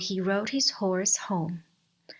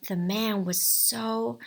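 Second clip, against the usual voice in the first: plain reading voice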